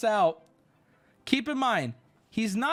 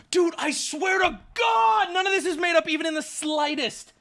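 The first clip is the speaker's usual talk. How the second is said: exasperated shout; 9 semitones higher, 4 dB louder than usual. Real, not acted. Storyteller accused of lying, shouting in exasperation. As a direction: insist at full voice, stretching the oath. Protesting to someone calling it lies.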